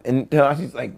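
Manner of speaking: usual speaking voice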